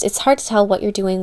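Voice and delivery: ordinary talk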